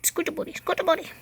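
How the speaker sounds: high cooing pet voice. Real, not acted. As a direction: urge a pet along in a high coo. Baby talk to her animal.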